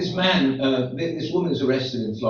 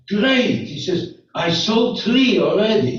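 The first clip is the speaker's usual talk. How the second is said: delighted; 9 semitones higher, 6 dB louder, slower than usual. Acted. Cheerful guard in joke.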